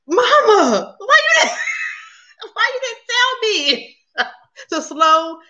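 high, laughing complaint; mock protest. Daughter protesting to her mama about fast talking, laughing mock outrage. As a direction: wail it through laughter, playfully.

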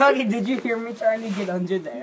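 loud, high excited question. Real, not acted. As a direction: bubble over with glee. Excited hider boasting.

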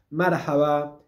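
Marhaba with the weight on MAR, then haba light; stress on first syllable. Shown as a demonstration. Teacher showing the stress of Marhaba to learners. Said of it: "El acento va en la primera sílaba".

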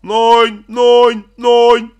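loud dictation. Acted. Father shouting the account digits to his son. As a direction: call the digits out slowly for the kid. Dictating bank numbers loudly.

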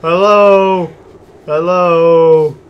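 loud, high nervous call into the dark; scared calling out. Real, not acted. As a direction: shout hello to something you can't see.

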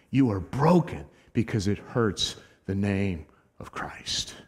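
whispered, soft and low; a whisper to close the point; hushed whisper. Real, not acted. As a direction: lean in and breathe it. Preacher drops to whisper.

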